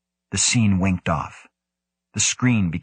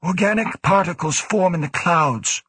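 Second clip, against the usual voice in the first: raised director voice; explaining director; 10 semitones higher than usual. A narrator's reading. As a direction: explain in a higher voice. Woe explains.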